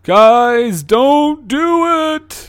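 slow, drawn-out ghostly warning. Acted. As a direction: moan it like a spirit. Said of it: "ghostly voices".

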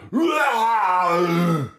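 full-volume monster roar; loud roar. Shown as a demonstration. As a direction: belt out the Rah. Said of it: "Instead of going".

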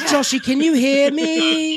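high, drawn-out teasing imitation; mocking mimicry. Co-host mimicking her question, mocking. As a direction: stretch it out high to mock her.

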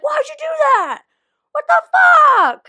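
high, loud shout of a frustrated gamer; angry gamer yell. Retold or imitated. Husband yelling at his friends over a video game, loud shout. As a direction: yell at your teammates mid-game like the husband. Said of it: "I can hear him being like".

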